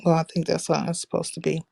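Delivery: soft whispered aside; whispering. Real, not acted. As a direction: whisper it to yourself. Aside while fixing settings.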